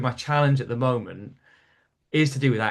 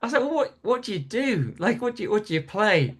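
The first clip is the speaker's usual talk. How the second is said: high-pitched incredulous questioning; mock-astonished, voice jumping higher; baffled disbelief; 7 semitones higher than usual. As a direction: replay your bewilderment, pitched well up. Retold bafflement at pub without darts.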